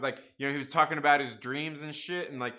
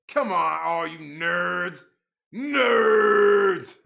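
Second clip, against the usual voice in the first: louder, drawn-out teasing call of "nerds"; mock taunt; 4 semitones higher, 12 dB louder, much slower than usual. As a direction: jeer at the chat like a playground bully, all in fun. Mock-scolding chat about baseball.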